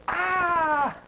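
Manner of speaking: high, loud startled scream. Retold or imitated. Woman finds a bear at the door, screams. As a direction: shriek in sudden fright. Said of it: "And she goes".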